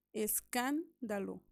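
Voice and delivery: escándalo slowly, leaning on cán; slow teacher's demo of escándalo, stressing cán; stress on antepenultimate syllable. Shown as a demonstration. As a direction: break after cán in escándalo. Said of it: "va a estar en la antepenúltima sílaba".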